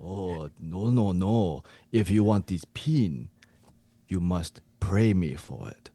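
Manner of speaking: hammed-up Japanese coach. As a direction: scold like a mock Japanese coach. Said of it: "you to be the Japanese coach".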